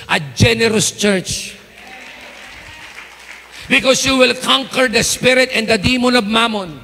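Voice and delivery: loud, high, driving sermon climax; full-force preaching. Acted. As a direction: preach it out to the whole church, building and pounding. Pastor preaching to the congregation. (malakas at mataas na pangangaral sa kongregasyon)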